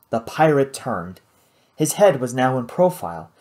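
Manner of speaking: usual speaking voice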